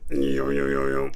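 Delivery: low, booming mock MC yo; deep put-on voice. Opening the video in a low booming MC voice. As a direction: drop the voice way down and boom it out. Mock hype intro to camera.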